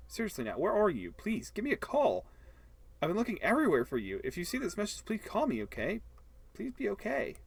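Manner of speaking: high, anxious voicemail plea; worried plea. Acted. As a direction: beg her to call back, fretting. Worried voicemail to missing Nat.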